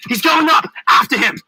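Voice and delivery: loud, urgent chase shout; yelled. A narrator's reading. Hero to Marquis as the Earl climbs, yelling. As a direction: shout the alarm and order. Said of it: "I yelled".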